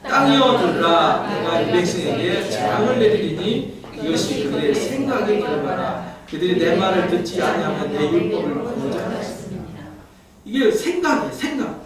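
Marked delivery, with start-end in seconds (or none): 0.1-9.2 measured pulpit scripture reading. Acted. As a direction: read it out like holy writ.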